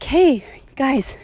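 high, sing-song playful God voice; mock voice of the creator. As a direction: tease them in a high, cutesy voice. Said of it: "that's like".

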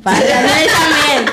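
high, loud, slow mocking echo; mock repeat. Teasing friend echoes the line in a put-on voice. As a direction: parrot it back in a silly put-on voice.

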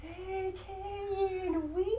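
very soft, high nonsense chant; Nell. Pretend. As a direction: croon it high and hushed. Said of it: "Calling you Nell".

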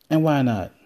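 ordinary talk

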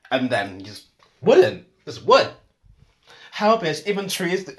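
fed-up rising rant; exasperated rant. Real, not acted. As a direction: let the outrage burst out. Ranting about cold-hearted people.

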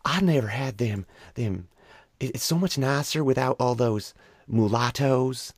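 hammed-up prim bigot, exaggerated; imitating the mom. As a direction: friends mocking the girlfriend's mom, exaggerated parody. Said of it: "imitate her and just take it as far as we possibly could".

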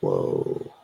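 very low, soft, drawn-out amazement; stunned awe. Retold or imitated. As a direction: let it out low and hushed, floored. His stunned reaction to her story.

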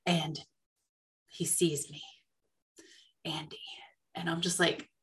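hushed whisper, barely any voice. Retold or imitated. Fan whispering as the star spots her across the room. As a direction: whisper it like you're starstruck and don't want to be heard.

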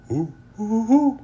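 high owl-like howl; howling hoo. A narrator's reading. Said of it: "He can go like a howl".

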